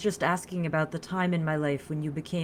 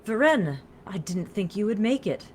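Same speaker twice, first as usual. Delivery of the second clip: higher, louder welcome; delighted greeting; 6 semitones higher, 5 dB louder than usual. A narrator's reading. Woman greeting her newly arrived lover, delighted surprise. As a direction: call out in happy surprise.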